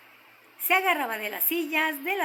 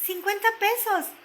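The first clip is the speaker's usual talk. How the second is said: eager half-shout, high and louder; almost shouting; 9 semitones higher, 5 dB louder than usual. A narrator's reading. Girl answering her father about the skates' price, almost shouting. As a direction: blurt it out high, nearly yelling. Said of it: "que casi gritando dijo".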